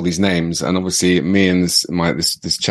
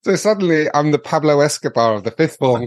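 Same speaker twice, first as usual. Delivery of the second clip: ordinary talk, nothing marked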